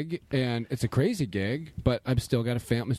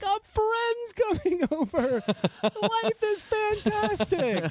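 put-on very high, slow gleeful kid; excited child; much slower than usual. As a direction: squeal it like a thrilled little kid. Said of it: "I love how kids".

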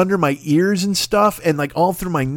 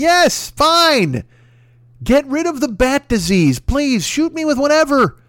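exasperated; 9 semitones higher, slower than usual. Real, not acted. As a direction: throw up your hands. Exasperated outburst.

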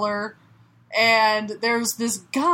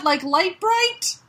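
drawn-out, higher-pitched disbelief; incredulous; 7 semitones higher, slower than usual. Real, not acted. Host doubts a character's name, incredulous. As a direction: question the name as if it can't be true.

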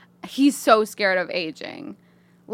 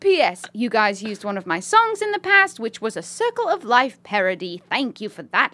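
put-on British lady accent. Reading a listener's email as a British lady, British accent. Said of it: "British lady writing this email".

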